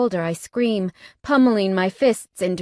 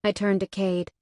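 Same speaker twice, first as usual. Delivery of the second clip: plain reading voice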